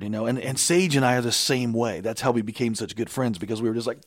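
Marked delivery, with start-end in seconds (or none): none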